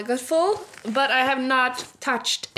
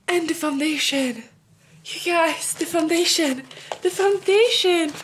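high gush, climbing with each repeat; mounting excitement; 6 semitones higher than usual. Real, not acted. Vlogger shows viewers the foundation, excitement climbing higher. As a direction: get more thrilled each time, pitch climbing. Gushing over new foundation.

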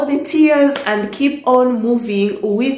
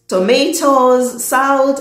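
normal talking voice

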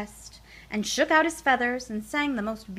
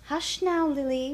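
usual speaking voice

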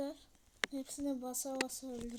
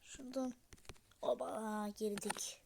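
whispering; 4 semitones lower, 5 dB quieter than usual. Real, not acted. Sneaking in the game.

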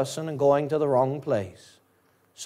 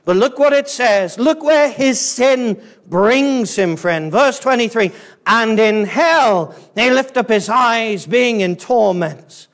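raised, forceful sermon voice; impassioned preaching; 6 semitones higher, 4 dB louder than usual. Real, not acted. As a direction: preach the scripture loud and high, leaning on key words. Preaches the torment passage.

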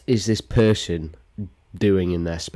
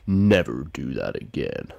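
low villain impression; Thanos; 6 semitones lower than usual. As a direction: deliver a deep, menacing mock threat. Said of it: "sounds like Thanos".

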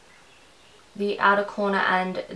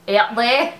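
Australian accent; 3 semitones higher, 7 dB louder than usual. Shown as a demonstration. Playfully slipping into Australian accent for viewers. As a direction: sing out "there" Aussie style with a lift. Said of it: "That's my Australian accent coming out".

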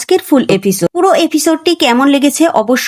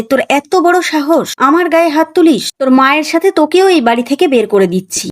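ordinary delivery

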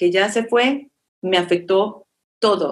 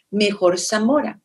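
usual speaking voice

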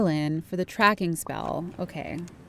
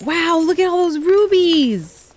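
excited; 5 semitones higher, 6 dB louder than usual. Real, not acted. Excited find of rubies.

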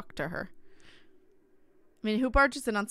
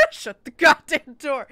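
loud, slow comic re-enactment; mock ghost shout; 6 semitones higher, 11 dB louder, slower than usual. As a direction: yell it as an amused imitation of an angry ghost. Repeats ghost's shout for laughs.

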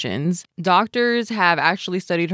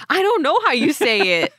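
high laughing voice; 10 semitones higher than usual. Real, not acted. Laughing at own pronunciation.

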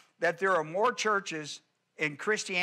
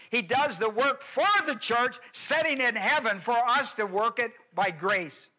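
raised, high, loud preaching build; preaching at full force; 5 semitones higher, 4 dB louder than usual. Acted. Pastor preaching to the church at full force. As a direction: preach it out to the congregation.

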